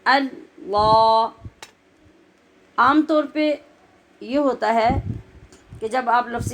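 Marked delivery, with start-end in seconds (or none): 0.0-1.3 اللہ very slow with a heavy, full lam; heavy, drawn اللہ. Shown as a demonstration. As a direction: draw out اللہ with a thick لام. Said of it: "علیحدہ علیحدہ".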